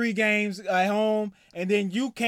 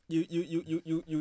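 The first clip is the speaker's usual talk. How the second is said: ordinary talk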